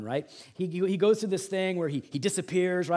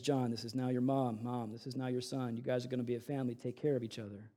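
tender; 7 semitones lower, 10 dB quieter, faster than usual. A narrator's reading. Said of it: "He says".

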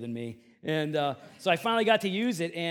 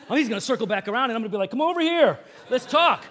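louder, fast, riled-up muttering to himself; worked-up fuming; 5 semitones higher, 8 dB louder, faster than usual. Retold or imitated. Pastor retelling how he stewed on the sidewalk, riled up and fast. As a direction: huff it out like a man itching for a fight. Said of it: "I'm like".

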